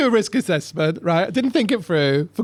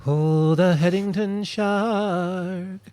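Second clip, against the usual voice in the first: slow, drawn-out wonder; long amazed sigh of recognition; awed recognition; much slower than usual. Real, not acted. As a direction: stretch it out in disbelief. Sees the shark picture.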